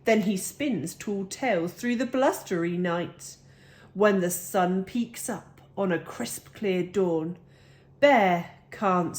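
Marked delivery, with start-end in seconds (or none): none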